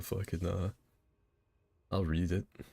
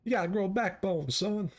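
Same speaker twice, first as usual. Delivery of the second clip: southern blowhard; 10 semitones higher than usual. Acted. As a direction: holler like a pompous rooster. Blustering southern rooster bit.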